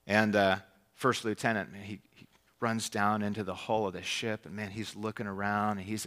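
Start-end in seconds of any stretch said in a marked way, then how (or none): none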